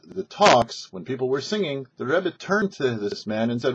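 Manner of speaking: plain reading voice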